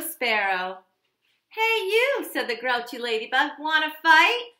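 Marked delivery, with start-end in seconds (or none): 1.5-2.2 loud high call; loud grouchy hey you; grouchy challenge. Acted. 3.6-4.5 loud taunting question; loud grouchy ladybug dare; grouchy challenge. Acted.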